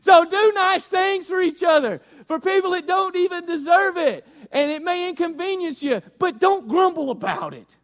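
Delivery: high, loud pulpit exhortation; preaching exhortation. Real, not acted. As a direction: drive the command home to the congregation. Preaches at full force.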